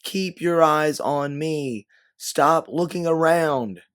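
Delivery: high, loud, drawn-out order; insistent shouting, stretched words; yelled command. A narrator's reading. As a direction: yell slowly to force someone's focus. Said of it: "you yell as you refocus back on her".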